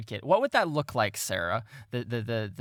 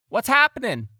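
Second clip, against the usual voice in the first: loud, high-pitched shouted question; urgent hail across the water; calling out; 13 semitones higher, 9 dB louder than usual. Acted. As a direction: yell over to the other boat. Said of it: "calling out to you all".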